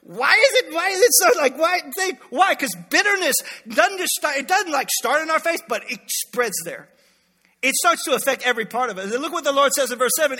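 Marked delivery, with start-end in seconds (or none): 0.0-5.6 loud, high fired-up preaching. Acted. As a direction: preach it out with full force. Preaching at full force.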